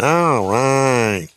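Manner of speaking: drawn-out catchphrase impression, reprised; Anita Martini. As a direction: stretch both words, gliding down. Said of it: "That's my Anita Martini impression".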